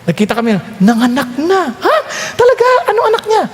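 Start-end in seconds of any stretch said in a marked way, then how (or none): 1.7-3.4 high, surprised disbelief. Retold or imitated. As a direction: gasp it out in disbelief. Surprised friend hearing car "gave birth.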